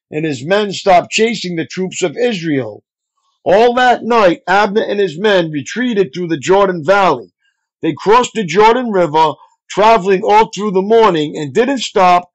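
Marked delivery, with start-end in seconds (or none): none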